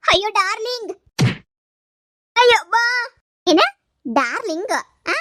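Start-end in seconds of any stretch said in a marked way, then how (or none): 2.4-2.7 high shrieking ow; pained scream. Acted. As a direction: scream as if hit. Mistress being beaten.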